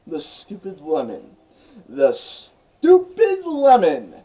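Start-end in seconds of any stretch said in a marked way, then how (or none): 1.9-4.2 much higher and louder, very slow and drawn out; grand dramatic announcement. Acted. Narrator presenting the outtake to viewers, grand and drawn out. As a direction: boom out "The stupid lemon" like a movie title, slow and loud.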